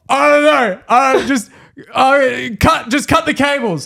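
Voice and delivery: high, panicked, stammering shout; imitated frantic supervisor; full stressing. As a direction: blurt it out in a flap. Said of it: "he's, like, full stressing".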